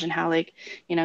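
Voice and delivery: ordinary talk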